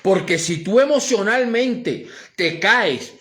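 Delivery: loud, high, driving sermon voice; full-force preaching. Acted. Pastor preaching to the congregation, fervent and loud.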